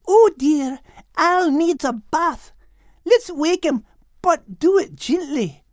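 a light motherly cat clucking over a mess; Kate, gentle. Acted. Kate asking the others to wake Al gently. Kate's voice.

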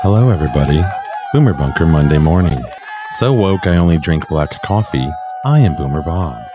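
booming radio host greeting; loud host intro. Acted. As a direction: open the show big and loud.

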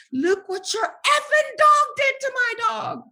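very high, loud screech of outrage; furious retold shout. Confronting the owners with her hurt dog, high furious shouting. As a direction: scream the accusation at them. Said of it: "I cursed them out".

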